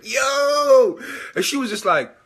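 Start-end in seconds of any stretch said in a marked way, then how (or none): 0.0-1.0 high, loud, thrilled Yo; a big excited yell of discovery; excited shout. Retold or imitated. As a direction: burst out with excitement. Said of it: "I was like".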